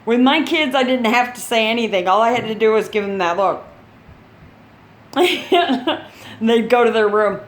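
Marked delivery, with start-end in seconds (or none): 5.1-6.0 high, brief chuckle; real laugh. Real, not acted. Mom recalls giving that look, laughing. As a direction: chuckle fondly at your own story.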